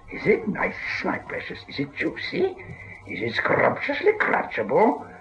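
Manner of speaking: soft hissing whispered creature voice, slow at the end; greedy sinister whisper; Gollum voice. A narrator's reading. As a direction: savour each word like a hungry cave creature. Gollum hungry for Bilbo.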